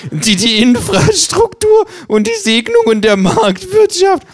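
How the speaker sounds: laughing kangaroo. Acted. Kangaroo breaking into laughter at its own parody, very high laughing. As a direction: crack up mid-sentence. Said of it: "Das Känguru fängt an zu lachen".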